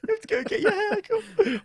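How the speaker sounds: very high, squeaky laughing voice; words barely escaping through laughter; helpless laughter. Real, not acted. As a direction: wheeze it out while cracking up.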